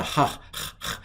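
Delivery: ha, h, h as a raspy back-of-the-throat breath; scraped h from the throat; throaty h sound. Shown as a demonstration. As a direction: push h out like German ach. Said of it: "like ich in German or lach in Scottish".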